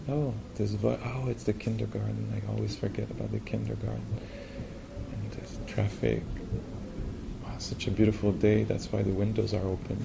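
soft, idle narrating to oneself; murmured inner commentary. Retold or imitated. Person describing reality to feel they belong, softly murmured. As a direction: imitate a quiet voice noticing things.

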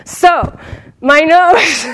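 high, loud mock complaint through laughter; monk's complaint, laughing. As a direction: deliver it as the absurd punchline. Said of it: "Senji said".

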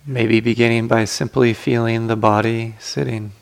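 guided meditation. Acted. Teacher guiding sitters to relax the body, calm guided meditation.

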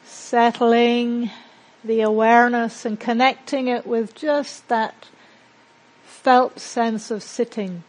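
measured meditation guide with long, settling pauses; guided meditation. Acted. Teacher guiding a group to settle into silence and breathe, guided meditation. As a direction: lead them into calm, leaving space between phrases.

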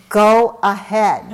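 loud, drawn-out dare; retold shout. Daughter daring her father to kick it, retold shout. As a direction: shout it slowly, as a challenge. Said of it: "And she shouts".

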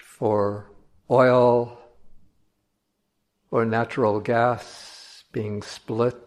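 measured meditation voice with long silences between phrases; solemn, unhurried contemplative delivery; guided meditation. Acted. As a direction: guide listeners slowly, drawing out key words.

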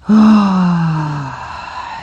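long falling sighed "oh"; kvetching sigh. Shown as a demonstration. Teacher kvetching and breathing with class, long falling sigh. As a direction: release the breath with a voiced groan. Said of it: "And quetching and breathing".

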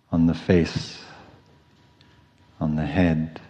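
unhurried meditation cue with long pauses; calm, spacious body-scan voice; guided meditation. Real, not acted.

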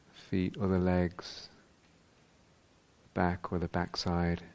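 unhurried meditation voice with long pauses; guided meditation. Real, not acted. Teacher guiding meditators into whole-body awareness, calm and spacious. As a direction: guide them calmly, leaving space after each phrase.

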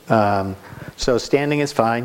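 ordinary talk, nothing marked

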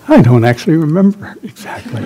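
laughing through the words. Real, not acted. Meditation teacher who forgot his point, laughing. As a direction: chuckle it out, amused at himself. Lost track of his own story.

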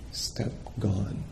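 name the step and let it go; quiet noting label. Shown as a demonstration. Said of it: "noting is like this".

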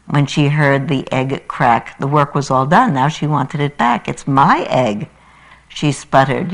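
animated rhyming storytelling; story reading. Acted. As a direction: read it like a children's book for the congregation. Preacher reads Seuss story aloud.

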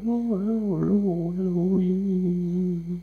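high, slow warbling coo to a newborn; cooing baby talk. Retold or imitated. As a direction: coo at a baby like a loving parent. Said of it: "It's kind of soothing, warm, warbling sounds".

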